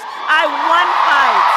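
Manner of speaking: slow, loud, emphatic; rally shout. Real, not acted. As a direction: punch it out slowly.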